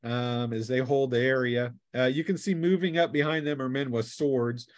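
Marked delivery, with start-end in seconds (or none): none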